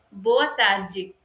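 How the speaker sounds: "Boa tarde" with tarde as "tar-jee"; Brazilian pronunciation. Shown as a demonstration. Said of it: "In Brazil".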